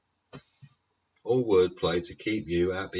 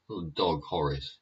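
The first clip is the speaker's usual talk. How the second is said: usual speaking voice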